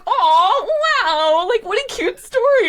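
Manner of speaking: high, mock-gushing coo; gushing crowd. Audience reacting to a cute story, gushing. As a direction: squeal it, half laughing. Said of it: "They're like".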